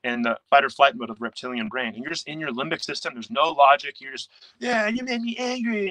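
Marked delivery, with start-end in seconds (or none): 4.6-5.9 put-on high, slow, sulky child voice; childish five-year-old. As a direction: whine it like a hurt little kid. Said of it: "you're acting like a five-year-old".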